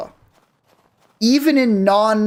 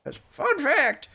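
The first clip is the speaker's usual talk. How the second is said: slow, high cowboy drawl; 7 semitones higher, 4 dB quieter, slower than usual. Pretend. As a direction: say it like an old cowboy. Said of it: "Why am I sounding like a cowboy now?".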